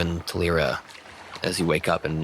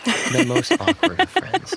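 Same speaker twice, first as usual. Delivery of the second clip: mock voice; 4 dB louder, slower than usual. GM echoes in a put-on voice.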